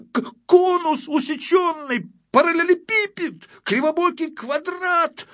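very high, loud, indignant squeal; outraged challenge. Acted. Photographer challenges the one who insulted his art, outraged. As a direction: stretch the insults out, sputtering with outrage.

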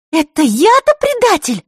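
high, loud indignant retort. Acted. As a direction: bristle at the insult. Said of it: "Так же тихо, ... возмутилась Захара".

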